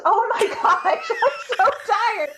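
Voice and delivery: high laughing voice; giggling and worn out. Real, not acted. Laughing fit mid-read.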